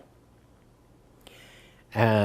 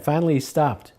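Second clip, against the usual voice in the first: ordinary talk, nothing marked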